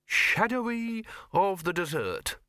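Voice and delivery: slow, high-pitched, puzzled read-out; artist reading aloud. A narrator's reading. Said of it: "the artist read".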